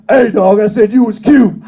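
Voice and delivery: loud, hostile yell; a hateful man shouting across the bathroom. Retold or imitated. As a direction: bark it at him, offended. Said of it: "he got hateful".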